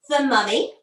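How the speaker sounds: plain reading voice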